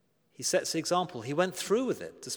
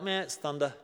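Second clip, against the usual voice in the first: softer, unimpressed shrug in the voice; dismissive meh; 2 semitones lower, 5 dB quieter than usual. Acted. Crowd shrugs off God's voice from heaven, dismissive. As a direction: brush it off, underwhelmed.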